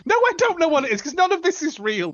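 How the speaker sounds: very high-pitched indignant outburst; exasperated protest. Real, not acted. Contestant telling the quizmaster none is real, exasperated. Rejects the quiz's absurd question.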